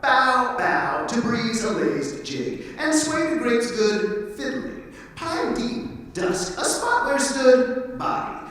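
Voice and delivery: animated spoken-word recital, pitch leaping; lively, theatrical poetry performance; performed poem. Acted. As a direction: punch out each image of the verse. Poet performs his poem.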